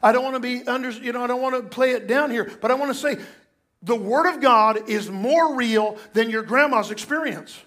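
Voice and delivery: ordinary delivery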